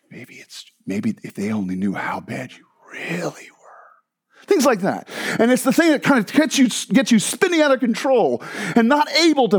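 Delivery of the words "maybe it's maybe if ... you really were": low, slow hissed whisper of accusation; demonic whisper. Pretend. Preacher voicing the demon's accusation, low slow whisper. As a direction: breathe it out like a voice in the dark. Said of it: "What is the demonic voice?".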